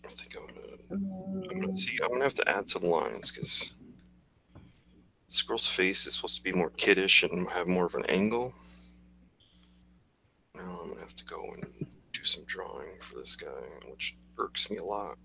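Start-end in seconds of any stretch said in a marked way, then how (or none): 0.0-2.0 whispered thought, trailing off; whisper to self. Real, not acted. Artist whispering to himself while fixing a drawing. 10.5-15.2 whispered grumble about the extra work; whisper to self. Real, not acted. Artist whispering to himself about redrawing a face. Muttering to himself while drawing.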